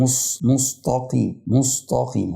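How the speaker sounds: "مستقيم" as mustaqeem with thick s and t; weight the middle of "مستقيم" into sad and taa; "مستقيم" mispronounced heavy, mus-taa-qeem; seen turned sad. Shown as a demonstration. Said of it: "بيقلبوا صاد".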